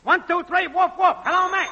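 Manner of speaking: high-pitched mic-check holler; woofing and yelling. Acted. Technician testing the network for Max, woofing and yelling. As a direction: yell out a test call to Max. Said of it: "woofing and yelling, "Hello, Max".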